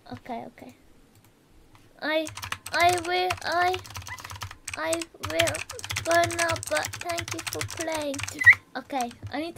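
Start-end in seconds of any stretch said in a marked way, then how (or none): none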